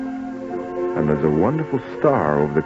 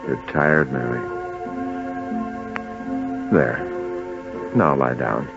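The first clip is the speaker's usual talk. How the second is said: low, gentle husband's voice; tender soothing; 10 semitones lower, 7 dB quieter than usual. Acted. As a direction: soothe her to rest, low and unhurried. Joseph soothes tired Mary.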